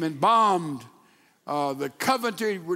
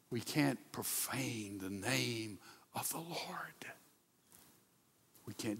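whisper; 9 semitones lower, 16 dB quieter than usual. Real, not acted. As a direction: breathe the warning to the room. Solemn warning to the congregation.

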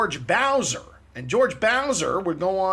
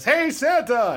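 louder, higher crowd call; crowd shouting back; 10 semitones higher, 6 dB louder, slower than usual. Retold or imitated. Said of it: "The whole crowd goes".

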